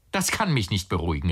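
usual speaking voice

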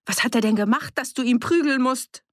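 hoch, laut und fordernd; wütende Frage. Acted. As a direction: stell ihn scharf zur Rede. Annie knöpft sich Fritz vor. (Annie stellt Fritz wütend zur Rede wegen der Prügel)

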